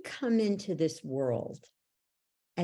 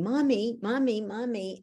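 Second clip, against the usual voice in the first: put-on child calling mommy; 6 semitones higher than usual. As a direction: call for mommy like a small child.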